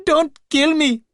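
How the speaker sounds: high, loud, sobbing begging; sobbing plea. A narrator's reading. Terrified man on the floor sobs for mercy. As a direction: beg for your life through sobs. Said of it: "he sobbed as he lay there".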